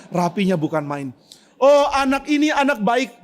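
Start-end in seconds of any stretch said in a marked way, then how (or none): 1.5-3.2 proud praise. Retold or imitated.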